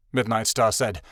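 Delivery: ordinary delivery, nothing marked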